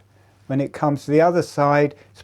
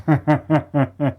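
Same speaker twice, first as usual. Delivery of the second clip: short run of quick, voiced chuckles; amused chuckle; 2 semitones higher, 6 dB louder than usual. Real, not acted. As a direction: laugh out loud at the Christmas rush. Joking about doing Christmas.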